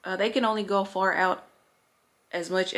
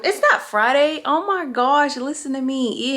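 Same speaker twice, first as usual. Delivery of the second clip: surprised; 6 semitones higher, 6 dB louder than usual. Real, not acted. Realizes she got the day wrong.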